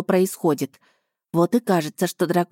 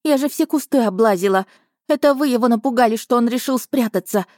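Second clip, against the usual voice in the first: tearful shout; 5 semitones higher than usual. Acted. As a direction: yell it through tears. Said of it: "выкрикнула девушка".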